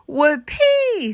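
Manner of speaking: a high, loud whoop of joy; excited cheer. Acted. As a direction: cheer like kids promised ice cream. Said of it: "said the kids".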